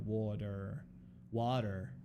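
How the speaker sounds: slow, low-key American "water"; American accent. Retold or imitated. English friend trying American pronunciation, American accent. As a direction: imitate American soft T and hard R. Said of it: "How do Americans say water?".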